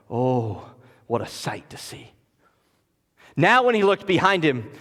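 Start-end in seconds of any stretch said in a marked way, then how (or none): 0.1-2.1 awed whisper. Real, not acted. Awe at God's army.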